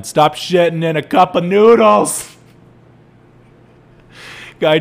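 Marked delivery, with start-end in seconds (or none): 0.0-2.4 loud yell, a bit higher. Retold or imitated. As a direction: yell it across the parking lot. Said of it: "start yelling".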